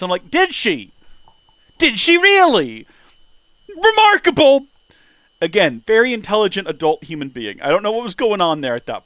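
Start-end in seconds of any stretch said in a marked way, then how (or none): 0.3-2.9 high, loud, slow mocking squeak; sarcastic disbelief. A kid mocking his friend's late discovery, high sarcastic squeak. 3.7-4.7 high, loud sarcastic exclamation; mock amazement. The streamer closing the peas story, high sarcastic cry.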